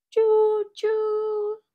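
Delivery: high loud choo choo whistle; train whistle cheer. Acted. Papa train cheering his son with a loud whistle. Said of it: "Papa Pufferbelly cheered".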